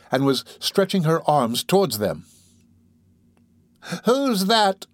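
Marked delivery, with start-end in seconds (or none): 4.1-4.9 high, hollow cry of a dying woman. A narrator's reading. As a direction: call out high and hollow from a deathbed. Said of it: "she cried in a hollow voice".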